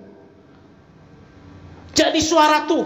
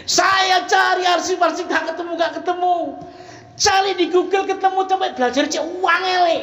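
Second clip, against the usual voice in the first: frantic retelling; 7 semitones higher than usual. Retold or imitated. Pastor recalling his frantic search for a theme, high and urgent. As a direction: rush like you're panicking.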